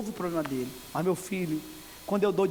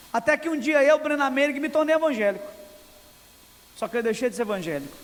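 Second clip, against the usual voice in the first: loud, higher declaring voice for the author; preached quote; 6 semitones higher, 4 dB louder than usual. Retold or imitated. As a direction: declaim the confession like a testimony. Preacher voicing Brennan Manning's book. (citação pregada em voz alta)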